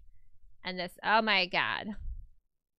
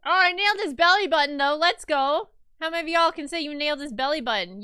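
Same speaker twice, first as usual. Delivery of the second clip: excited bragging; 5 semitones higher, 7 dB louder, faster than usual. Real, not acted. Boasting about drawing.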